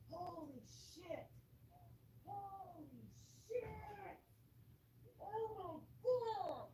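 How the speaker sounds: very soft, high and wondering; hushed awe. Real, not acted. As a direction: drop to a tiny, amazed murmur. Hushes self, marveling at storm.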